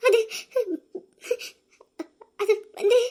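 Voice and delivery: halting words broken by sobs; crying. Acted. Tearful sister asked what happened, crying and stammering. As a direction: try to answer through tears. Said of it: "ஏன்க்கா அழுதுட்டு நிக்கிற".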